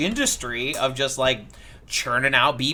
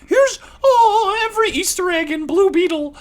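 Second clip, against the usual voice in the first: much higher, squeaky mock presenter; mocking YouTuber voice; 4 dB louder than usual. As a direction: parody a breathless YouTuber. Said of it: "who's like".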